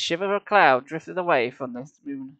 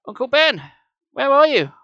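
high, loud call; calling for missing uncle, louder and higher; 10 semitones higher, 9 dB louder than usual. A narrator's reading. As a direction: shout into the dark. Boy calls into pyramid.

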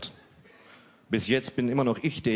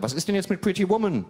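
higher, pointed question; girlfriend voice; 10 semitones higher, 4 dB louder than usual. Retold or imitated. Girlfriend demanding the film, higher and pointed. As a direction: press him, higher and pointed.

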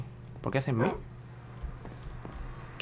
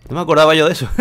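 excited; 3 semitones higher, 12 dB louder, faster than usual. Real, not acted. Excited realization about breeding.

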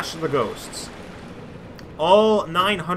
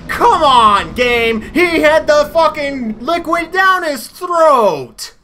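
screaming in real outrage, much higher and louder; furious shout; 13 semitones higher, 11 dB louder, slower than usual. Real, not acted. Gamer screaming at the game after an unfair kill, furious shout. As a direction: yell it at the game like it cheated you.

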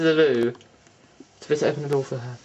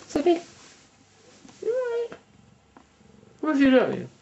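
high coaxing call; coaxing pet voice; 11 semitones higher, faster than usual. Real, not acted. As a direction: coax the cat sweetly. Talking to the cat.

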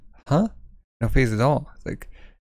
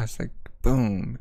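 ordinary talk, nothing marked

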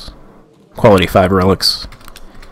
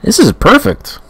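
sarcastic. Real, not acted. Troops lost, mock praise.

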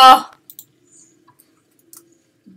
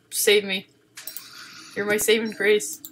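ordinary delivery

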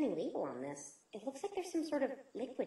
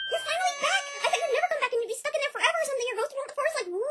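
much higher, louder, fast excited pony; bubbly Pinkie voice; 7 dB louder, much faster than usual. Acted. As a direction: gush with hyper excitement. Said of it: "Good voice".

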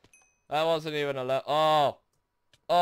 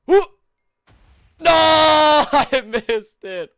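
anguished shout; 11 semitones higher, 11 dB louder than usual. Real, not acted. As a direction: howl it, then sink into defeat. Misses again.

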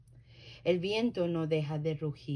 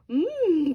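sniffing hum; 8 semitones higher, 7 dB louder than usual. A narrator's reading. Badger smells food.

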